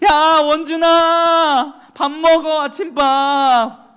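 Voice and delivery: high, drawn-out mom calling her son; mother calling up the stairs, high and stretched; mom waking child. Retold or imitated. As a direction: call him to breakfast like a mother. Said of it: "엄마가 흔듭니다".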